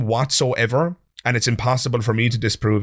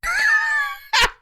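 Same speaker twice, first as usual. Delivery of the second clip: incredulous laugh. Real, not acted. Host reacts to "magical world.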